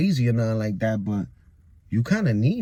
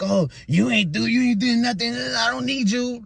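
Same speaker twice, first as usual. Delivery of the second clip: higher, mocking take on her complaint; mocking his girlfriend; 9 semitones higher than usual. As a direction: whine it back at her, slowing at the end. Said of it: "she be dead saying stuff like that".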